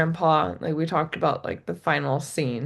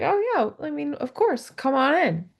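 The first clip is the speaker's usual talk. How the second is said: mock naive welcome; 9 semitones higher than usual. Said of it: "where it's just like".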